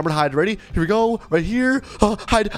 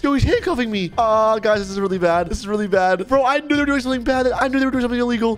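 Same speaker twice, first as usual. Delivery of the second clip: panicked; 6 semitones higher than usual. Real, not acted. Being handcuffed by gang.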